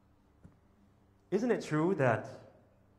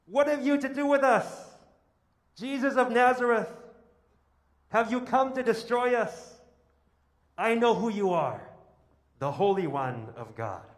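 high, loud, shrieking demon voice; unclean spirit crying out; 8 semitones higher, 6 dB louder than usual. Acted. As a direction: cry out in a panicked spirit's voice. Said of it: "He cried out".

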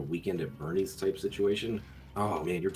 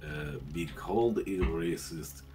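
hammed-up Russian accent, halting delivery. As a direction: put on a thick comic Russian accent. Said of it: "do anything but a Russian accent".

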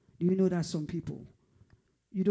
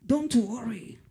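higher, louder don't worry; loud urging; 6 semitones higher, 6 dB louder, slower than usual. Acted. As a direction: lift it and call out don't worry. Preacher comforting congregation.

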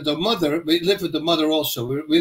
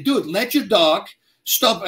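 ordinary delivery

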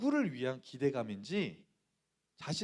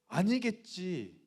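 slow, stunned self-denial; stunned disbelief; 4 semitones higher, much slower than usual. Retold or imitated. Boy hoping the money wasn't gone, slow stunned murmur. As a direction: drag it out in disbelief.

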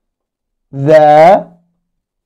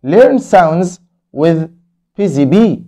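slow title read; much slower than usual. Shown as a demonstration. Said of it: "teacher slow title read".

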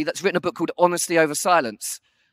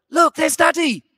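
put-on high, loud excited call to the kids; cheery wife calling out; 8 semitones higher, 3 dB louder than usual. Wife on the boat cheering the kids to wave back, excited. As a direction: wave and call out brightly, higher. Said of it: "And Diane's going".

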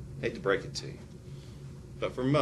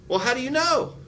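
pitched way up, mock questioning; mock high puzzled question; 12 semitones higher than usual. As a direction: squeak it out like a baffled guard. Acted dialogue voice.

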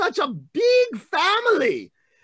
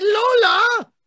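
surprised call; 9 semitones higher, 4 dB louder, slower than usual. Retold or imitated. Brother calling out to sister.

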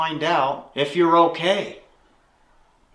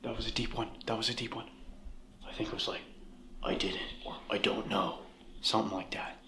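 very quiet, low murmur; hushed aside; 9 semitones lower, 17 dB quieter, faster than usual. Real, not acted. Investigator guesses what a voice said, hushed and low. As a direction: keep it down, almost whispering.

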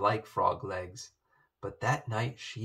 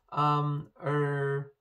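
nervous hesitation; 4 semitones higher than usual. A narrator's reading. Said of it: "the maid began nervously".

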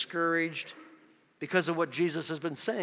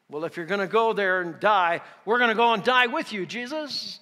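loud, higher-pitched vow of loyalty; fervent loyalty; 5 semitones higher, 8 dB louder than usual. Acted. Preacher voices Thomas pledging to die with Jesus, fervent loyalty. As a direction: pledge it loudly, voice climbing. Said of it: "his statement is one of loyalty".

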